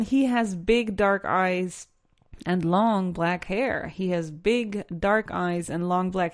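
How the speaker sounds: "he has big dark eyes" spaced word by word, very slow; slow dictation. Shown as a demonstration. Teacher slowly dictates the test sentence to students.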